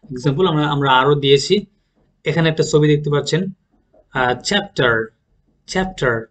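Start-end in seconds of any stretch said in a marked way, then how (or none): 4.4-6.3 চ্যাপ্টার with first syllable stressed; careful pronunciation. Shown as a demonstration. Teacher shows learners the word, careful pronunciation. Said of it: "এটা হবে চ্যাপ্টার".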